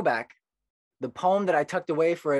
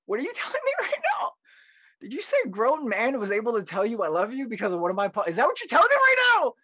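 high-pitched, incredulous squawk building louder; mock disbelief; 10 semitones higher, 6 dB louder, faster than usual. Poet reacting to a grateful stranger, hammed-up disbelief. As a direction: replay being floored, voice shooting up to a loud peak. Acting out own stunned reaction.

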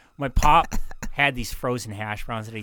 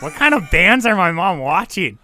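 higher, much louder incredulous outburst; shocked disbelief; 7 semitones higher, 9 dB louder than usual. Retold or imitated. Son reacting to mom's concert picture with loud disbelief. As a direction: blurt it out, floored by mom's taste. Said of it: "I was like".

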